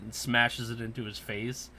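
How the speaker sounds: ordinary talk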